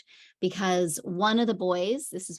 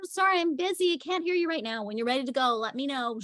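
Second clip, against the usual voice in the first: much higher, light, breezy brush-off; breezy sing-song; 6 semitones higher, faster than usual. Retold or imitated. Nanny brushes off the yelling boy in a high sing-song. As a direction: sing it high and airy, unbothered.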